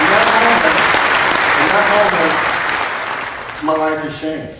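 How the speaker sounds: loud, high declaration over the crowd; impassioned testimony. Real, not acted. Speaker testifies to the crowd about his changed life, impassioned. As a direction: ring it out over the applause.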